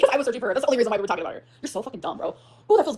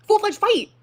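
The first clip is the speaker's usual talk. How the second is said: higher, louder shout of the phrase; outraged shout of full-fledged fight; louder emphatic repeat; 9 semitones higher, 7 dB louder, much slower than usual. Retold or imitated. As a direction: hit it harder the second time.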